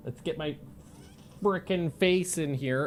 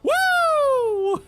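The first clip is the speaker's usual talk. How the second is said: gleeful laughing; 7 semitones higher, 5 dB louder, much slower than usual. Real, not acted. Gleeful cackle at kill.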